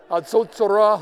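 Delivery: Hatzotzra blared loud and high like a trumpet; trumpet call. Shown as a demonstration. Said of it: "pretend to be playing the trumpet".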